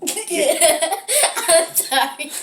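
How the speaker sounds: apology lost in giggles; laughing. Acted. Pupil caught laughing while classmate must answer, apologizing through giggles. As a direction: burst out laughing, then squeeze out an apology. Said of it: "Why are you laughing?".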